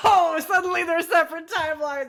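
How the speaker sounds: high, laughing mockery; mocking disbelief. Real, not acted. Co-host pounces on the separate timelines excuse, mocking. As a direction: throw the excuse back, high and teasing.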